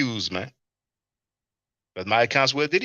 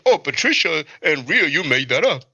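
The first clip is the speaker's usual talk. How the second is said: mock accusation; 4 dB louder than usual. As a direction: ham up the silly accusation. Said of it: "Can you picture me saying".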